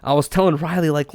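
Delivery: ordinary delivery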